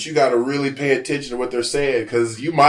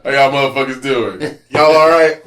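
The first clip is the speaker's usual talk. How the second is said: loud Kansas greeting, a light put-on accent; 7 dB louder than usual. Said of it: "I'm back to normal".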